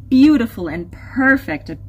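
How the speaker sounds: usual speaking voice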